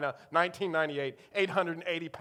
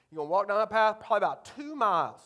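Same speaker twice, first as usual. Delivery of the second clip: quick, louder Eastern North Carolina impression; thick Eastern North Carolina accent; 4 semitones higher, 5 dB louder, faster than usual. Dad's friend gives directions to teenager, Eastern North Carolina accent. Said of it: "he's got a thick Eastern North Carolina accent".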